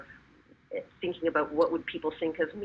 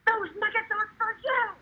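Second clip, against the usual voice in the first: high, strained outburst at husband; outrageous story yell. Acted. Mother to husband caught taking the kids' nuggets, outrageous yell. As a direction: squawk it at a husband stealing food.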